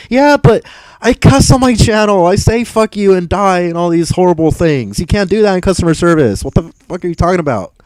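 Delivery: high-pitched, indignant rebuttal; exasperated outburst. Real, not acted. Streamer rebutting a viewer's customer service comment, exasperated. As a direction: fire back incredulously, pitch way up.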